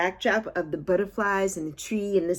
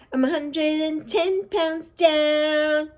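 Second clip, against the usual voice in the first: drawn-out, high, slow celebration; excited; 9 semitones higher, much slower than usual. Real, not acted. Vlogger to viewers, proudly announcing her weight loss, slow and high. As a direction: savor every word like a victory shout.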